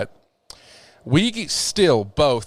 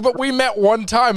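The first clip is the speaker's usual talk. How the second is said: retold protest; 11 semitones higher, 4 dB louder than usual. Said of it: "I was like".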